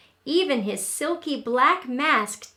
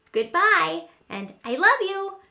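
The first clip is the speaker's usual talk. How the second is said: put-on cheerful child; 7 semitones higher, 5 dB louder than usual. A narrator's reading. Child says goodbye.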